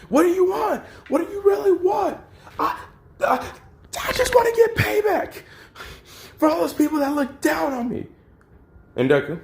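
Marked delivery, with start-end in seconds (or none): 0.1-2.2 screaming demand. Retold or imitated. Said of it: "he's just screaming". 2.6-8.1 very high, stammering shout of pent-up grievance; desperate shout. Retold or imitated. Villain answers, shouting.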